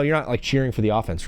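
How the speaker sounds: usual speaking voice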